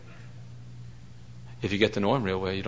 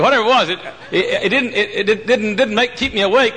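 laughing; 11 semitones higher, 4 dB louder than usual. Real, not acted. Laughing at the whiskey story.

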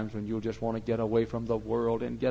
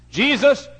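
loud, slow, raised refrain; emphatic preaching shout; 12 dB louder than usual. Real, not acted. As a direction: proclaim it loudly, drawing it out. Emphatic repeated refrain in sermon.

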